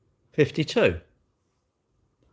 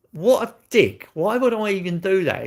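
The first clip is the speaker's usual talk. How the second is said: louder, higher self-scolding; self-mocking scorn; 7 semitones higher, 4 dB louder than usual. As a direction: scoff at your own silly idea. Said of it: "And I thought".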